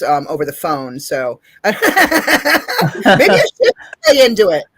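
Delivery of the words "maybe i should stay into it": slow, laughing through the words. Real, not acted. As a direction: chuckle it out at yourself. Joking about being called sir.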